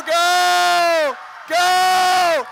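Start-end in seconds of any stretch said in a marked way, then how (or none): none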